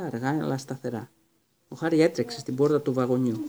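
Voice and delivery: ordinary delivery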